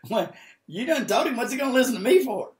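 high, loud incredulous objection; comic protest. Retold or imitated. Preacher arguing with God about telling the man, comic protest.

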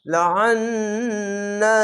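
লাআন্না held long, slow recitation; long madd. Shown as a demonstration. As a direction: draw out লাআন্না fully as in tajweed. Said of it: "তিন আলিফ টেনে পড়বেন".

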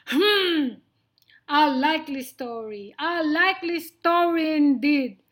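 loud, scornful lion; disdainful sniff. Acted. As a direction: scoff loudly in disbelief. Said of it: "sniffed Lionel".

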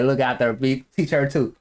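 ordinary talk, nothing marked

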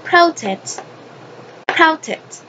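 "protect" with high first syllable, then falling; pronunciation model. Shown as a demonstration. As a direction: model "protect" as PRO-tect. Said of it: "So, the pronunciation is".

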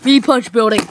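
dumb brute talking in grunts; slow, loud caveman speech. Acted. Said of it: "Rock buff".

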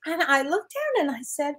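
ordinary delivery

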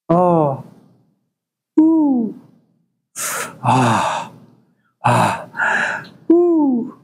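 breathy mock moans jumping up and down in pitch; mock moaning. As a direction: imitate the neighbor's pleasure sounds, overdone. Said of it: "Does that sound familiar?".

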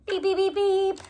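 playful beeping car horn; car horn beeps. Pretend. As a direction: beep like a toy car. Pretending box is race car.

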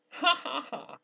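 high, hammy theatrical cackle; villainous laugh. Acted. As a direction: throw back your head and cackle. Gomez laughs at his own joke.

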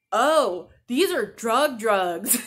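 sudden alarmed realization, half joking; patient realizing her pills are hard drugs; mock realization. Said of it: "I'm like".

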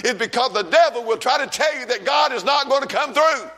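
revival-preacher fervor; fiery preaching. Acted. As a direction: preach it hard to the church. Preaching to congregation.

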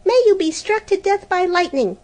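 high, loud furious curse; cursing. Acted. Helena furiously cursing her sister. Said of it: "Helena began to curse her".

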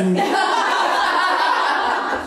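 bursts into high breathy laughter; laughs. Real, not acted. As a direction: laugh out loud, caught off guard. Coach laughs at the straw remark.

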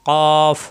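"কফ" thick, from the back of the tongue, drawn out; heavy qaf. Shown as a demonstration. Teacher showing the letter to students, "কফ" heavy and long. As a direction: hold a full, heavy "কফ". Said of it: "মোটা হবে".

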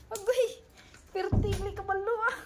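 high, laughing with delight. Real, not acted. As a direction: laugh it out, happily amazed. Laughing at barking dog. (natatawa sa tuwa)

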